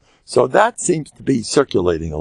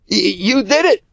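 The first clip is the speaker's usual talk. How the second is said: imitated caller; 10 semitones higher than usual. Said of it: "she tells me on the phone".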